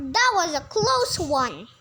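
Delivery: ordinary delivery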